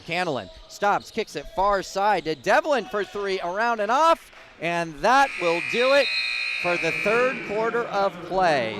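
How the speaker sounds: loud, high buzzer-beater call; excited buzzer call. Real, not acted. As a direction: shout the last shot of the quarter. Last shot of the quarter.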